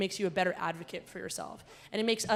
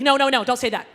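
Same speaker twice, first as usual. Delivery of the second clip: mock refusal; 5 semitones higher, 11 dB louder than usual. Retold playful refusal.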